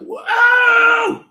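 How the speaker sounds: sudden very high, loud shout; imitated yell. Preacher imitating a fierce manager's yell, very high and loud. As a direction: bark a fierce boss's yell. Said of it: "yang galak teriak".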